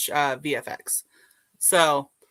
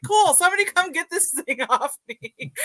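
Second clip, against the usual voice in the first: laughing plea; 12 semitones higher than usual. Retold or imitated. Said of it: "I was like".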